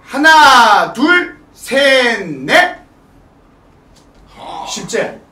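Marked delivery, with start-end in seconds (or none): 0.1-2.8 slow, stretched count for a dance move; drawn-out dance count. Shown as a demonstration. Teacher showing the inward hand move, drawn-out count. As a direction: call each beat out long.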